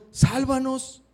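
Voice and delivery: "Sálvanos" agudo y fuerte; súplica a Jesús. Retold or imitated. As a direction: ruega con urgencia. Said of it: "fueron con Jesús y le dijeron". (los discípulos suplican a Jesús en la tormenta)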